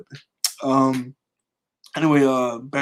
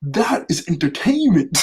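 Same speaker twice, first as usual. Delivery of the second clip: a slow gloating boss line, a bit higher and louder; mock gloating villain; villain quote; 4 semitones higher, 5 dB louder, slower than usual. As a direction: savour the word entertainment like a showman villain. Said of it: "He goes".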